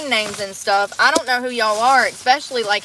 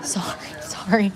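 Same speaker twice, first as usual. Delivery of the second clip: whispered sorry; 2 semitones lower, 5 dB quieter, slower than usual. Real, not acted. Apologizing in a whisper.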